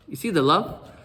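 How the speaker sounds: ordinary delivery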